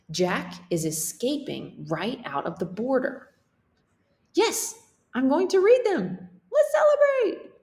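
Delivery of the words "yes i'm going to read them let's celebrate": loud, very high cheer; celebrating the finished book, loudly and very high; excited cheer. Acted. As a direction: celebrate at the top of your voice. Characters celebrating.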